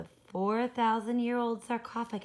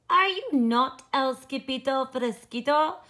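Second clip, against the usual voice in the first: loud challenge; 5 semitones higher, 12 dB louder than usual. Acted. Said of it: "asked Poquito Tito".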